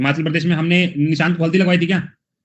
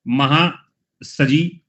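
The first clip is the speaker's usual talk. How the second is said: very slow, drawn-out name; stretched out; much slower than usual. Shown as a demonstration. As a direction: draw out महासजी slowly. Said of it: "stretched mnemonic word". (महासजी को धीरे-धीरे खींचकर)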